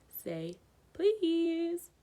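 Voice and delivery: high, drawn-out teasing; teasing poltergeist. A narrator's reading. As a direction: make him beg. Peeves teasing Filch.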